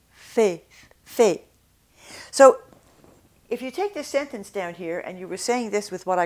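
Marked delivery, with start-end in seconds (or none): 0.1-1.4 "fait" held on one tense e; no glide on "fait"; French side of the drill; French pure vowel. Shown as a demonstration. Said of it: "in French".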